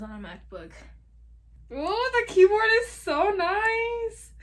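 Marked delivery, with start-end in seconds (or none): 1.7-4.3 high, loud, slow gush of delight. Real, not acted. Typing on the new keyboard, loud high delighted gush. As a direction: gush like it's the best thing ever.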